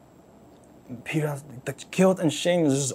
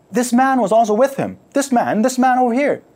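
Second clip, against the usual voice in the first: loud, high pointing accusation; accusing shout; 9 semitones higher, 7 dB louder than usual. Acted. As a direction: call him out across the fire. Servant girl accusing Peter.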